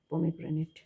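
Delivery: usual speaking voice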